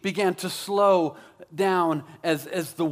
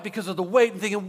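normal talking voice

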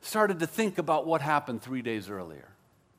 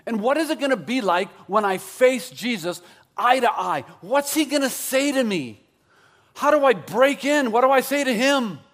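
rising to higher, louder worried questions; acted anxious questioning; 5 semitones higher, 4 dB louder than usual. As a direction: build the imagined worry question by question. Imagines Peter's panicked self-questioning.

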